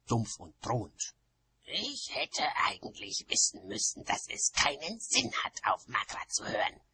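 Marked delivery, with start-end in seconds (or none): 1.6-6.8 growling, disgruntled witch; grumbling, partly whispery growl. A narrator's reading. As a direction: growl it with bitter regret. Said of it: "knurrte Oma Wetterwachs".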